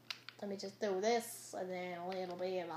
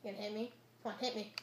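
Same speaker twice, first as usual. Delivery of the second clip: usual speaking voice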